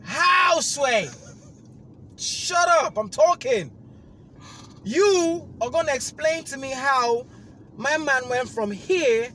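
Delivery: very loud, very high, slow yell; furious yell. Retold or imitated. Yelling at the agent on the phone, furious. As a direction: scream the question at them.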